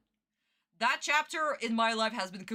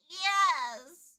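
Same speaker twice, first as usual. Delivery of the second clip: high little pupil's reply; obedient child; 5 semitones higher than usual. Retold or imitated. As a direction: imitate a child answering the teacher. Voices the child's reply to teacher.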